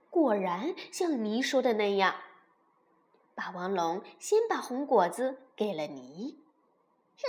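usual speaking voice

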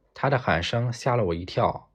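plain reading voice

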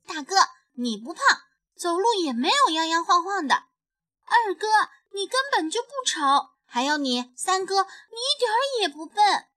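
high, loud, fast little-sister scolding; indignant defending. A narrator's reading. Little bunny defending her brothers, high and indignant. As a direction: rattle through each brother, outraged.

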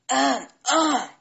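loud, deliberate "ahem, ahem"; throat clearing. A narrator's reading. Storyteller grandpa before his spell, clearing his throat. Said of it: "故事爷爷清了清嗓子".

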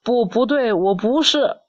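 high-pitched, mildly distressed protest; storybook refusal, pitched high; acted upset denial. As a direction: protest like an upset young dinosaur. Young dinosaur denying what he is.

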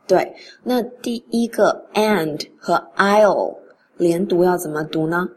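ordinary delivery

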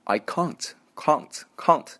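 slow British "can't" with a long "ah"; British accent. Shown as a demonstration. Teacher shows how the British say it, British accent. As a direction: say "can't" slowly as British "cahnt". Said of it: "英国人发音".

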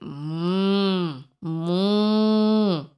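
long, drawn-out imitated moo; cow moo. A narrator's reading. Cow greets the spider with drawn-out moos. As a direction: moo like a cow in a picture book. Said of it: "said the cow".

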